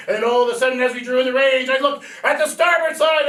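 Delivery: usual speaking voice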